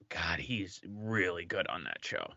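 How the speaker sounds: hushed aside, barely voiced; whisper. Real, not acted. Guest whispers praise for the actor's other show. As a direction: whisper it like a secret compliment.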